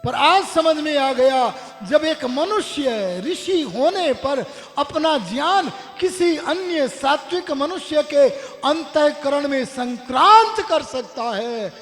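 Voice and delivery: excited shout. Acted. Disciple shouting his sudden realization to his guru, excited and loud. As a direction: shout it out as the truth finally lands. Disciple leaps up, finally understanding.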